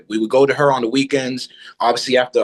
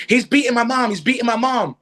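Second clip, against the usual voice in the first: panicked pleading; 9 semitones higher than usual. Retold or imitated. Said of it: "I'm panting".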